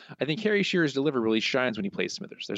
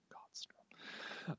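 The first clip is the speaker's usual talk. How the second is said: whisper; 22 dB quieter than usual. Real, not acted. Whispered reaction to quote.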